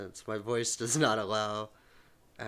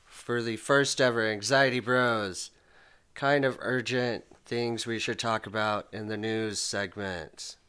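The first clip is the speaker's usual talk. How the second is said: slow, loud segment announcement; show announcer; 5 dB louder, slower than usual. Acted. Host introducing the news segment, slow announcer voice. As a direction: announce it big, stretching the words out.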